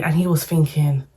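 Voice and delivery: ordinary talk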